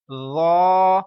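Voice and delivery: teacher shows the heavy letter ذا; heavy full sound. Shown as a demonstration. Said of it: "موٹی آواز میں کیوں پڑھا".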